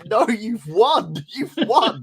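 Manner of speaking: high, loud mock scream, breaking into laughter; exasperated high yell, played for laughs; retold screaming. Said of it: "I was screaming at my phone".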